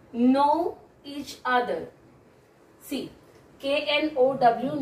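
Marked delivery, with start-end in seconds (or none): 0.1-1.9 slow, deliberate title read; slow and clear. Shown as a demonstration. Teacher announcing the topic slowly to students. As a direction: spell it out slowly for the class. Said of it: "And the topic name is".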